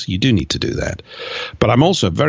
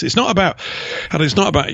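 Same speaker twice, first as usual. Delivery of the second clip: getting emotional; 7 semitones higher, faster than usual. Real, not acted. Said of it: "okay, so I'm slightly getting emotional".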